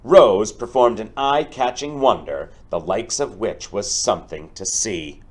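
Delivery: building up the stampede, bright and energetic; lively storybook read-aloud. Acted. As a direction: make the rescue exciting for the kids. Teacher reads picture book aloud.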